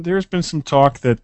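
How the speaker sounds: normal talking voice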